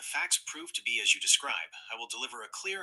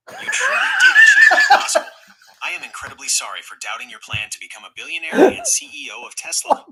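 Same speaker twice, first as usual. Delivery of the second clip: wildly high, much louder wail that tips into a laugh-shout; a sob that blows up into a squealing laugh; hammed-up crying voice; 8 semitones higher, 15 dB louder than usual. Shown as a demonstration. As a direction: overplay the crying apology until it cracks into a shriek. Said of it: "a little bit more of a crying voice".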